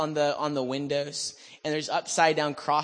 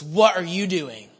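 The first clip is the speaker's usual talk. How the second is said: slow, louder, retold challenge; confronting demand; 6 dB louder, slower than usual. Stranger at the door confronts him on the steps, mock confrontation. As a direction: demand it slowly, acting the affronted stranger.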